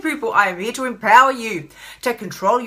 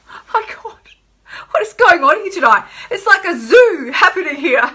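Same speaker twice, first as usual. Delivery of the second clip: laughing disbelief; flustered surprise; 7 semitones higher, 5 dB louder than usual. Real, not acted. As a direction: gasp then laughing exclaim. Interruptions, flustered.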